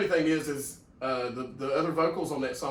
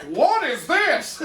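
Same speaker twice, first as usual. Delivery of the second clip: high, loud, drawn-out disbelief; mock horrified shock; 12 semitones higher, 8 dB louder, much slower than usual. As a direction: act out an appalled teacher's outcry. Imitates band teacher's shocked reaction.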